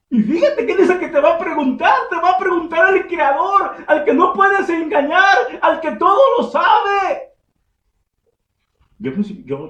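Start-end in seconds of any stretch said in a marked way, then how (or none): 0.1-7.3 higher, louder, building to a shout; excited preaching. Real, not acted. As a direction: build up to the all-knowing Creator.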